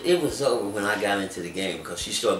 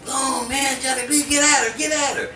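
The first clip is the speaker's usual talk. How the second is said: higher-pitched pleading push; brother's high urging voice; 4 dB louder than usual. Retold or imitated. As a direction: egg him on like a hype-man brother.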